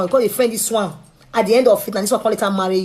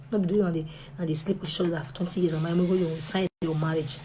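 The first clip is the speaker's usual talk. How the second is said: ordinary talk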